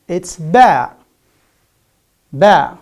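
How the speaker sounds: "ba" said loud with a voiced b and no puff of air; clear ba sound. Shown as a demonstration. Teacher showing students how to say ba, loud and clear. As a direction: give the class a model "Ba.". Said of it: "How do we pronounce it?".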